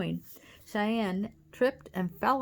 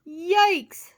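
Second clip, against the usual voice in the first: high, loud yelp; alarmed shout; 11 semitones higher, 10 dB louder than usual. A narrator's reading. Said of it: "shouted the giant slug".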